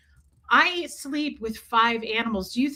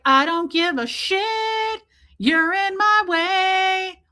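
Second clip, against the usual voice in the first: high, slow, loud and blunt; voicing the cat Moose; 8 semitones higher, 3 dB louder, much slower than usual. Owner voices her cat Moose, slow, high and unbothered. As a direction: drawl it out high like a cat who doesn't care. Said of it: "He's like".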